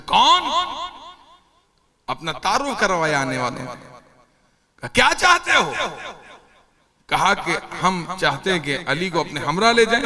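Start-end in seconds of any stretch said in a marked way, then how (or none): 0.1-0.4 higher, demanding call; challenging question. Acted. Acted question through the door. 4.9-5.7 high, demanding question; defiant challenge. Acted. Acted confrontation at the door.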